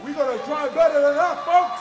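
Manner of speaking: hyping the crowd. Acted. Crowd work, louder.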